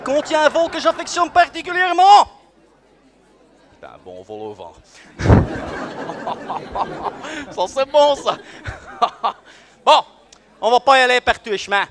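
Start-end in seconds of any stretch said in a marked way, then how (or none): 5.2-7.5 breathy chuckle. Acted. Laughs at own pun.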